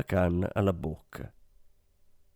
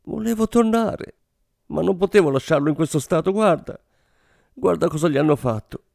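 high tearful plea, no real sobs; a frightened woman begging through tears; lightly voiced tearful Frenchwoman, much higher; sobbing plea; 8 semitones higher than usual. A narrator's reading. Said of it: "singhiozzò".

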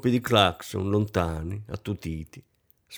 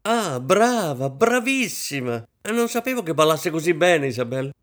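gleeful cry; 7 semitones higher than usual. A narrator's reading. Hostess applauding Isabel's dancing, crying out gleefully. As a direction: cheer her on with showy delight. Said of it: "applaudì e gridò ridendo".

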